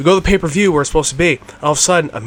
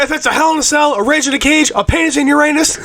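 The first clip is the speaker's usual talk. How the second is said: higher, mock wrestling promo; imitating The Rock's promo; Rock impression; 10 semitones higher than usual. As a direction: cut a hammed-up promo. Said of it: "I love when he goes".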